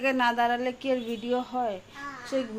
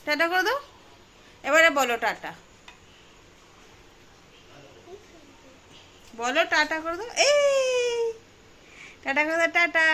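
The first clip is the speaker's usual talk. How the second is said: yelling across the room; 6 semitones higher than usual. Real, not acted. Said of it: "ওখান থেকে কেউ শুনতে পাচ্ছে না".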